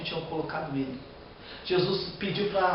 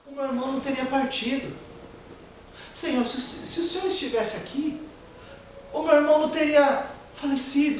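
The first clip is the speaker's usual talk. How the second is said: anguished plea; 9 semitones higher than usual. Acted. Preacher voicing Mary pleading at Jesus' feet, high anguished cry. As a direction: cry it out to the Lord as the grieving sister. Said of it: "Falou a mesma coisa que eu".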